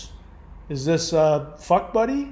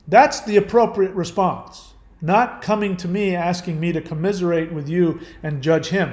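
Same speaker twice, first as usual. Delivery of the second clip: usual speaking voice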